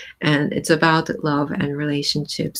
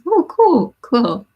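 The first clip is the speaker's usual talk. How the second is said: excited; 6 semitones higher, 4 dB louder, slower than usual. Real, not acted. Reacting to a viewer comment.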